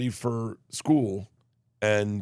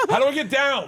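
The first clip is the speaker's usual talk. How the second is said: high, loud mock cry for help; panicked child's yell; 14 semitones higher, 5 dB louder than usual. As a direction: holler like a kid stuck up a tree. Child stuck atop a tree.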